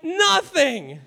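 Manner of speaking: high-pitched comic confession; imitating a clueless reply; mock admission. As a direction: deliver it as a high, absurd punchline. Acted absurd admission, punchline.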